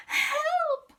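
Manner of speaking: very high frightened cry; squeak. Acted. Peter cries out at the dog, high frightened squeak. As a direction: squeak it in panic. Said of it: "squeaked Peter".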